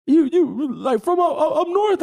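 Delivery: high, mock-baffled questioning; mimicked puzzled exchange. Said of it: "I'm like".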